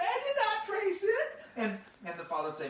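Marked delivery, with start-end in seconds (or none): 0.0-1.4 very high, loud squeal of protest; hammed-up shrieky indignation; indignant little sister. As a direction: squeal the denial like an outraged child.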